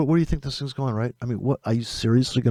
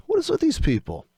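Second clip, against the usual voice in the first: real exasperation, pitch shooting high after a sigh; sigh, then burst out high at them; exasperated; 13 semitones higher than usual. Real, not acted. Trader fed up with YouTube gurus, pissed-off outburst. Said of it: "I always get pissed off".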